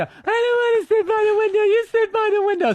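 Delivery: very high, whiny kid imitation; mock a panicking child refusing; freaking-out toddler. Toddler refusing mother's window seat, high mock whine. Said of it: "the kid is freaking out".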